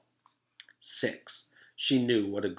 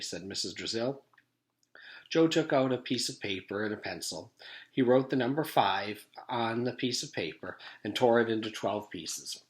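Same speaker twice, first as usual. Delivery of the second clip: plain reading voice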